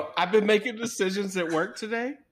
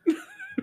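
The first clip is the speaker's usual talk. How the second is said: a sudden high squeal of a laugh. Real, not acted. Friend cracks up at the dirty anagram, high squeal.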